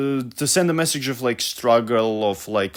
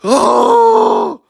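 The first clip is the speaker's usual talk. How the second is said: loud, high cry of exasperation; high exasperated outburst; frustrated scream; 11 semitones higher, 9 dB louder than usual. Real, not acted. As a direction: let out a loud frustrated yell. Vents after finishing the summary.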